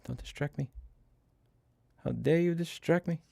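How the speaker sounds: high, soft mock scolding; pet talk. Real, not acted. Telling his cat off in high, soft mock-scolding pet talk. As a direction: tease the cat in a sweet voice.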